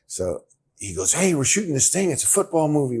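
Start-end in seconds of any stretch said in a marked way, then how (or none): 1.1-3.0 higher, louder eager buddy pitch; eager invitation. Retold or imitated. Roommate urges his buddy to join the movie, eager. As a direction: sell it like an excited roommate.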